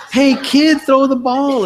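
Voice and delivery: put-on high, loud holler to a kid; imitated high-pitched yell; mock calling out. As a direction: call it across a ball field, hammed up. Acting out a called-out movie line.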